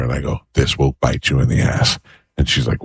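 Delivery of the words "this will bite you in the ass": low-pitched retold threat; mock ominous warning. Husband repeats his warning over the soda, mock ominous. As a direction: keep it low, like a grave prediction.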